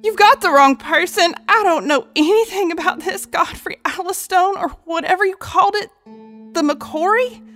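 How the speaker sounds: high, frightened woman near tears; tearful pleading yell. Acted. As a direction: beg for your life through tears. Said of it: "tears streaming down her face, she yelled".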